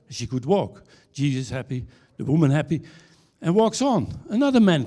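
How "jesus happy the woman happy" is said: ordinary delivery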